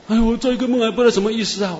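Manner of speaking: a hint of a worried church sister; sister puzzled. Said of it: "说". (姐妹略带困惑的口吻)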